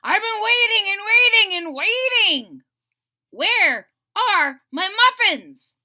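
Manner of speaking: a hungry dragon crying for his muffins; rumbling dragon. A narrator's reading. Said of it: "he rumbled".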